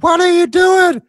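high, loud mock scolding; angry coach. Coach scolding player, retold.

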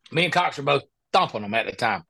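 ordinary delivery

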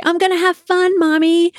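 put-on high, sing-song little-kid voice; gleeful child. Daughter defying mom at camp drop-off, imitated gleeful voice. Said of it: "she'd laugh and say".

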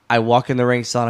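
normal talking voice

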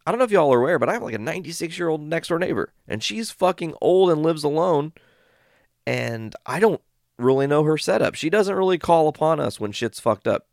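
high, emphatic warning; reenacted worked-up plea; urgent insistence. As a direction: press the point home on the phone. Urgently warning utility about elderly neighbor.

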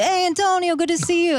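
very high, exuberant Italian-accent imitation; sing-song mock Italian hello; [name]'s Italian greeting. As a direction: hail a buddy in a hammed-up Italian accent. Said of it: "this Italian accent the whole time".

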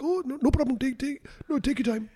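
high, soft put-on voice; gently mock the guest's voice; imitating Farhan. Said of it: "and he's like".

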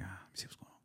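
hushed half-awake whisper. Retold or imitated. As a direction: whisper it groggily. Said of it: "unvoiced whisper".

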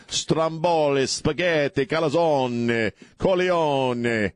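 high, hammed-up Italian-American greeting and food list; mock Italian accent. Host on a sports radio show, in a mock Italian accent.

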